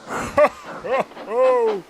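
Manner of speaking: high breathy ho ho laugh. A narrator's reading. Gordon laughs at Thomas stuck in a mine, mocking laugh. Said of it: "laughed Gordon".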